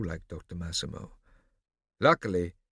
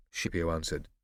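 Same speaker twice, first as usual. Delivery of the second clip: ordinary delivery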